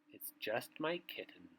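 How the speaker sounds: ordinary delivery, nothing marked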